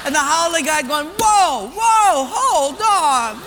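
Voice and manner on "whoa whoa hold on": high, loud cries of objection; loud protest. Acted. Haole guy objects to the ruling, loud protest. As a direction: call a halt, loud and high.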